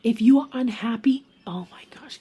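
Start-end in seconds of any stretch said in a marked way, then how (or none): 1.5-2.2 hushed whisper, the last words nearly gone. Real, not acted. Reader whispers to viewers, close to tears. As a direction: lean in and whisper it. Reader moved while giving advice.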